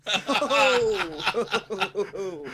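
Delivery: stretched out through laughter; drawn-out laughing name. Acted. Drags the name out, laughing at the co-host. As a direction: drag the name out while laughing.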